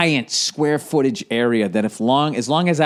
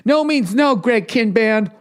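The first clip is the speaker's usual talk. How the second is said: loud declaration; 8 semitones higher, 4 dB louder, slower than usual. Acted. Calling out a name.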